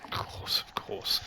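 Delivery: whisper. Real, not acted.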